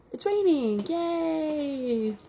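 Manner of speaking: high-pitched little squeal of delight; delighted cheer. Real, not acted. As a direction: break into a drawn-out, singsong yay. Breaks off, delighted at sudden rain.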